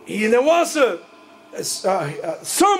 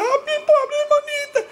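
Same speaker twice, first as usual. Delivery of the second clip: high-pitched wailing, hammed up; mock crying. Sibling pitying the supposedly kidnapped sister, mock crying. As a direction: imitate a tearful sister's squealing lament. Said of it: "minha irmã começa a chorar".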